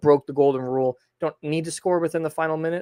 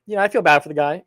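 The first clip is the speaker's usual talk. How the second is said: ordinary talk, nothing marked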